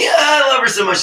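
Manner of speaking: bursting out laughing, high and loud; real laughing shout of fondness. Real, not acted. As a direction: laugh it out with delight. Laughs at daughter's line.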